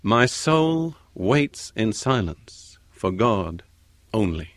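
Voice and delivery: "My soul waits in silence for God only" read very slowly; a preacher slowing right down to read the psalm; slow scripture reading. Shown as a demonstration. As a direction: let each word of the psalm sink in. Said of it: "They are the words of David".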